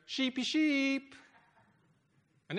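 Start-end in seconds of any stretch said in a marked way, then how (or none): 0.1-1.1 very high, slow, drawn-out call to the flock; high-pitched teenage call, a little louder; teenage girl calling sheep. Retold or imitated. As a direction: sing out like a sixteen-year-old girl calling the sheep in. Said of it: "in a sixteen-year-old's high-pitched voice".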